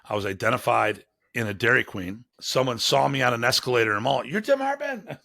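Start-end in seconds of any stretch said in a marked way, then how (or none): 4.2-5.2 much higher, starstruck recognition; excited fan. Retold or imitated. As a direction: blurt it like a thrilled fan who spotted a celebrity. Excited stranger recognizing him.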